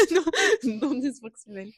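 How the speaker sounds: laughing through the words; giggling it out. Real, not acted. Laughing correction. (en riant)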